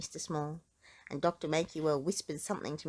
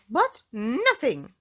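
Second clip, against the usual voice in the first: slow, louder furious retort; angry shout; 5 semitones higher, 7 dB louder, slower than usual. Acted. Mister Uppity silencing Mister Small, slow furious shout. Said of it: "shouted Mister Uppity".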